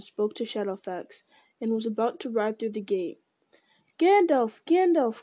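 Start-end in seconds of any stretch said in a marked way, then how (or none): 4.0-5.2 high, loud call for Gandalf; crying out. A narrator's reading. Pippin calling after Gandalf, loud cry. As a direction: shout his name to stop him. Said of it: "cried Pippin".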